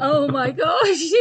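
slow, amused oh my gosh through laughter; breaking into a real laugh at the story. Real, not acted. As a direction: laugh it out in disbelief. Host reacts to a funny remark.

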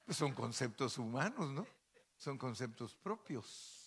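ordinary talk

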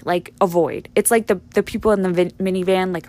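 ordinary talk, nothing marked

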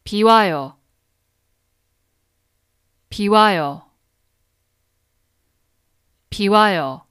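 비 와요 repeated clearly, each set apart; dictionary pronunciation. Shown as a demonstration. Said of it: "I'm gonna repeat it three times. Listen carefully".

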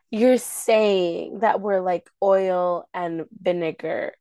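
slow, drawn-out imitation; slow talking. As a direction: stretch the words out as if imitating a slow talker. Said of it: "you're talking slow".

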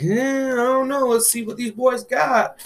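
high-pitched dubious "eh"; skeptical reaction. Retold or imitated. Wrestling fan retelling his doubt about a new team, high and skeptical. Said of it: "I was just like".

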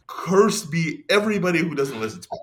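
hammed-up preachy curse, not fully committed; bombastic outburst. Imitating Paul's bombastic outburst to listeners, lightly mock. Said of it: "his sudden onsets of".

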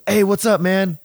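high, bright-sounding greeting from a friendly crew guy; lightly voiced cheery stagehand. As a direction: chirp it like a cheery backstage worker.

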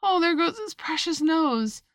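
high-pitched, drawn-out cry; agony of terror. A narrator's reading. Alice fearing for the baby's nose, high alarmed cry. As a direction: wail it in alarm. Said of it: "in an agony of terror".

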